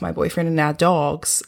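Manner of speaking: ordinary talk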